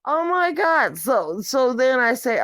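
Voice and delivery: ordinary talk, nothing marked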